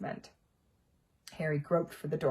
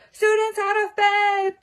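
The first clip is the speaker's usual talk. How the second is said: high, much louder poltergeist bellow; slow, shrieking alarm call; 12 dB louder, slower than usual. Acted. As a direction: raise the alarm at full volume. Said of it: "Peeves bellowed".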